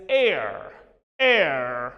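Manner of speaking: "air" said slowly, one long open vowel; pronunciation demo. Shown as a demonstration. Teacher models the word for students, careful "air". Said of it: "Be correct about the pronunciation".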